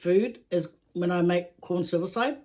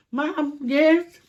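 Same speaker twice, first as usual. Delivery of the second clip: put-on higher playful kid voice; lightly voiced child answering mum; mock kid voice; 8 semitones higher, 5 dB louder than usual. Imitating her kid answering mum, higher. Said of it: "I was sort of like".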